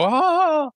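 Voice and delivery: high imitated swoon; mock blissful "Ah"; swooning sigh. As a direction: mimic someone melting with delight. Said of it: "they go".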